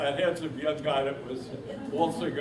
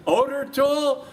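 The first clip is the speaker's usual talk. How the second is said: high, louder questioning mock surprise; surprised question; 11 semitones higher, 5 dB louder, slower than usual. Retelling the Polish veteran's eager question, high and surprised. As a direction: ask it eagerly in a much higher voice.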